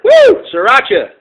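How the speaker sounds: loud high whoop of triumph; excited whoop. Real, not acted. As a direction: cheer as the lantern lights. Lantern lights up.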